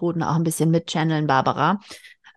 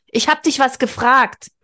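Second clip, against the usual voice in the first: higher and louder, insisting on an answer; nagging demand; 5 semitones higher, 4 dB louder than usual. Acted. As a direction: keep nagging, demand a reply. Keifte Mathilda.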